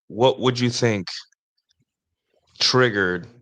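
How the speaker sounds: ordinary talk, nothing marked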